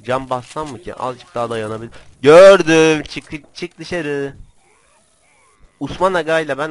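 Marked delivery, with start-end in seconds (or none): 2.2-4.4 very loud, drawn-out call; shouted sighting. Real, not acted. Player spotting someone, loud drawn-out shout. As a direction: holler it out, stretching the word.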